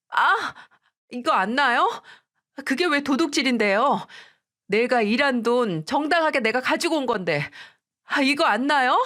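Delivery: daughter-in-law yelling back at the mother-in-law; angry protest. Acted. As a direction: cry out and argue while your hair is pulled. Narrator fighting back while hair pulled.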